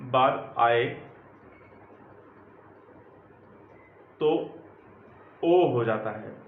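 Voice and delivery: slow, low, word by word with long pauses; slow dictation. Acted. Teacher dictating the rule to students, slow and measured. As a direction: spell it out for them to write down.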